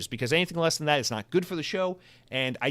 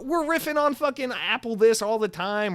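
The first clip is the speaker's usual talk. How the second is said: ordinary delivery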